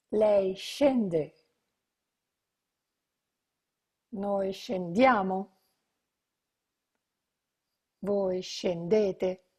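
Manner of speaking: slow, clear, spaced forms for repetition; slow model conjugation. Shown as a demonstration. Teacher modelling scendere slowly for students to repeat. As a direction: model each form slowly for the class. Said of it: "let's practice then conjugating these verbs".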